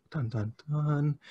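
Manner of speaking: slow, softly voiced dun-dun-dun; spoken dramatic sting, drawn out; mock suspense sting. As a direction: play the suspense music with your mouth. Mock suspense sting while reading.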